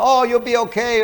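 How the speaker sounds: high, breezy reassurance; too upbeat. Shown as a demonstration. Parent rushing to a crying child, overly chirpy. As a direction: pop up with instant cheer. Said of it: "don't automatically pop up".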